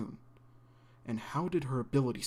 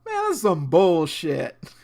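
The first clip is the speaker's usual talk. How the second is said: loud, slow fed-up complaint; disgusted; 4 semitones higher, 11 dB louder, much slower than usual. Real, not acted. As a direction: drag it out in disbelief. Reacting to episode ending.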